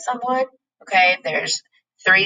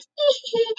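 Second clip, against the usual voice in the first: a squeaky, high-pitched imitated giggle. Teacher imitating Kimberly laughing with her friend, high giggle. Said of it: "Kimberly's like".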